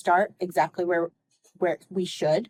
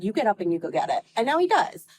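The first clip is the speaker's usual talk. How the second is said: ordinary talk, nothing marked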